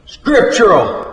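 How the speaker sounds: high, drawn-out holler; mocking holler. A narrator's reading. Cafe owner answers the cook with the preacher's word, hollering. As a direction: call it out high and slow, throwing his word back.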